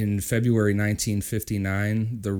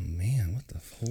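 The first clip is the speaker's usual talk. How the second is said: frustrated whisper; 7 dB quieter than usual. Real, not acted. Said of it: "frustrated at names".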